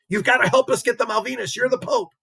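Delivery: high-pitched imitation of an insistent plea; pleading president. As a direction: press the Pope in a high mock-pleading voice. Acts president pleading with Pope.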